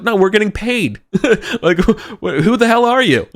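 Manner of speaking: high, loud, incredulous shouting with a laugh; mercenary insisting he's only paid, baffled; baffled yell. Acted. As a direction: sputter in disbelief. GM voicing NPC, laughing.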